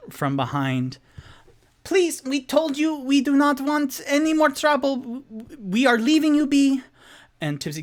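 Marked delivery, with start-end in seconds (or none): 1.8-6.8 high, loud, scared begging voice; pleading creature. Acted. Frightened rat-folk begs the adventurers to leave. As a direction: beg them to leave you alone.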